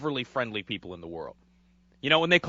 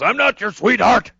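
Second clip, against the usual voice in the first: loud, high indignant shout; angry old man; 7 semitones higher, 7 dB louder than usual. Retold or imitated. Old man yelling at the counter woman, loud and high. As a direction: snap back, offended. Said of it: "And he goes".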